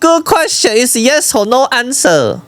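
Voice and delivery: high, hammed-up Chinese-accented protest; exasperated Chinese accent.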